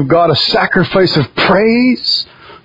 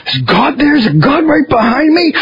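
higher hushed amazement; awed wonder; 8 semitones higher than usual. Pretend. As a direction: ask as if sensing a presence. Imagined awe in prayer.

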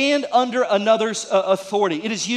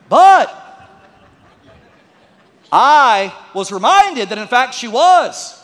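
louder, slow build to the punchline; preaching punchline; 2 semitones higher, 8 dB louder, slower than usual. Real, not acted. Pastor landing the punchline for the congregation, louder. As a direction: land the twist with a raised voice. Punchline about the sinner child.

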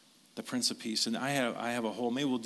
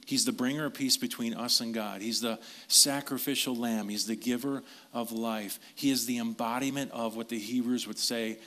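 ordinary talk

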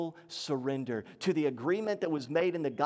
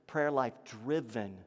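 ordinary talk

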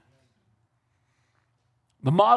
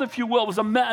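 ordinary talk

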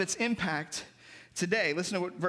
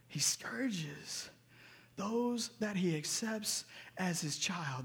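hushed; 8 dB quieter, much slower than usual. Real, not acted. Preacher drops voice for weight.

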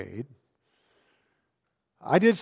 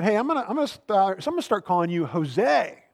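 put-on higher, fast bratty kid voice; mock punk kid; 6 semitones higher, 3 dB louder than usual. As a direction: tease like a smug sixth grader. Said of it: "some punk sixth grader came up to me and said".

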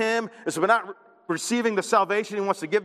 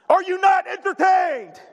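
much higher, louder, slow arena roar; gladiator movie quote; 10 semitones higher, 4 dB louder than usual. Retold or imitated. Pastor quotes a gladiator line to the church, slow booming shout. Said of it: "anytime you mention a movie line".

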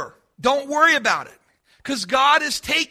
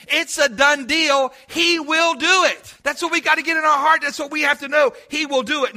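full-force preaching; 2 semitones higher, 4 dB louder than usual. Acted.

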